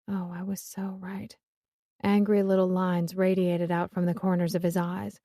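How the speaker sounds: ordinary delivery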